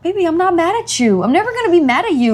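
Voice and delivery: very high, coddling parent voice; motherly reassurance. Retold or imitated. Mother reassures child in retold talk.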